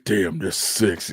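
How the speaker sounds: very low, slow, soft imitation; mock seductive voice. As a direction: tease with a deep slow purr. Repeats the mocked low voice.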